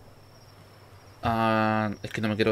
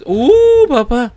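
high, loud startled yelp; startled scream; 12 semitones higher, 15 dB louder, much slower than usual. Real, not acted. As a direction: jump in fright and shout. Startled by something in game.

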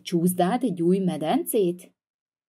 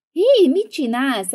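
outraged shout; 7 semitones higher, 7 dB louder, slower than usual. Acted. Laptop grabbed from brother.

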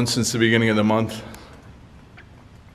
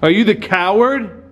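higher, challenging call into the dark; provoking the spirit; 7 semitones higher, slower than usual. Real, not acted. Ghost hunter challenging the spirit to give its name, raised taunting call. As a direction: taunt the presence to answer.

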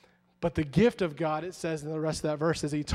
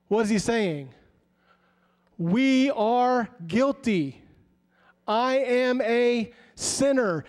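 hammering each word of judgment; fervent preaching; 5 semitones higher, slower than usual. Acted. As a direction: drive the point home to the congregation. Preaches at full force.

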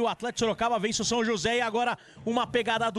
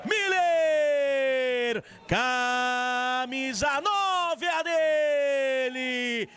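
stretched high shouting goal call; explosive goal commentary; 4 semitones higher, much slower than usual. Real, not acted. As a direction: roar the scorer's name, drawn out.

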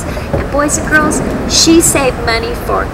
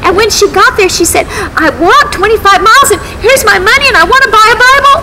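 rising, quickening excitement; eager little girl, laughing with joy; excited; 9 semitones higher, faster than usual. A narrator's reading. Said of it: "She was so excited".

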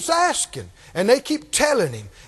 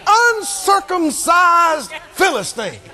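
slow, higher scornful proclamation; looking down the nose; 5 semitones higher, slower than usual. Shown as a demonstration. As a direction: sneer it haughtily, drawing out each syllable. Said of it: "look down your nose when you say it".

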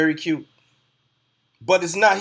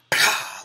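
unvoiced spat blaster sound; laser blast noise. Reviewer mimicking a stormtrooper blaster firing at Kylo. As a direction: spit out a quick pew like a shot.

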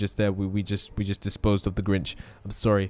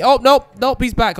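sudden high, loud game alarm; startled alarm; 12 semitones higher, 10 dB louder than usual. Pretend. Player sees the Grinch is back, playfully alarmed.

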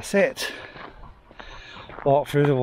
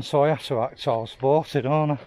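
ordinary delivery

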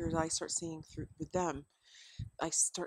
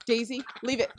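calling the dog; 4 semitones higher, 6 dB louder than usual. Real, not acted.

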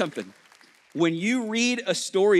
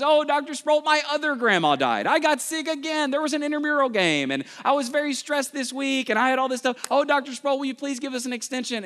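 much higher pleading whine; hammed-up whining student; whiny students; 10 semitones higher than usual. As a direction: overdo the begging, more desperate. Students begging again, more extreme.

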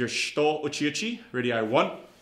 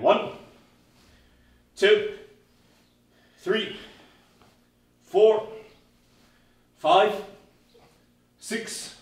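higher, louder drill count; calling the count; 6 semitones higher, 4 dB louder, much slower than usual. Real, not acted. Instructor counting push-ups for blue belts, called out. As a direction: call out each number for the class.

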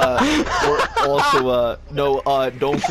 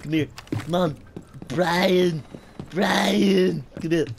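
cartoon baby calling his pal, names pitched up; Stewie Griffin; 4 semitones higher, slower than usual. Gamer playfully calling Brian over, cartoon impression. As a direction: coax with drawn-out, higher calls. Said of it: "I know I am Stewie".

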